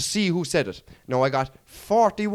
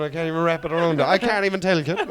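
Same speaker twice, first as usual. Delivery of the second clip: ordinary talk, nothing marked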